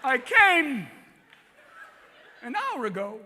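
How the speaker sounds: clipped Walken cadence with strange breaks; deadpan eerie seduction; Christopher Walken. Retold or imitated. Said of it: "Chris would be like".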